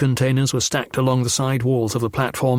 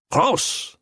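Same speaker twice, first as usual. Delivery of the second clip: gunman's bark; 10 semitones higher than usual. A narrator's reading. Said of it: "the gunman said".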